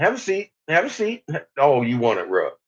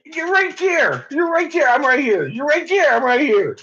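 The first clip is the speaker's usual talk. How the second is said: high coaxing; 11 semitones higher than usual. Real, not acted. Coaxing the dog over.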